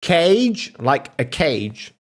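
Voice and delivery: slow, with the word cage stressed; slow teaching. Shown as a demonstration. Streamer teaching chat, slow explanation. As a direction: spell it out slowly for chat. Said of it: "this is one way to do it".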